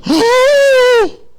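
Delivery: high, loud drawn-out gasp of amazement; amazed gasp. Acted. A rabbi hearing young Jesus' answer, amazed gasp. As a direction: gasp in mock astonishment.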